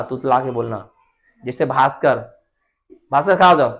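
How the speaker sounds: ordinary delivery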